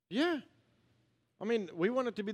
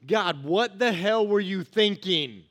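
louder, slow, exasperated rant at God; indignant complaint; 2 semitones higher, 7 dB louder, much slower than usual. Retold or imitated. Habakkuk complaining to God, loud and slow. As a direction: demand answers in slow outrage. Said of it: "Chapter one".

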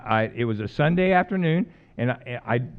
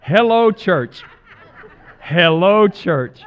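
loud laughing call to the congregation; 8 dB louder, much slower than usual. Real, not acted. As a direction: call out to the church, amused. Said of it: "Is that not the truth".

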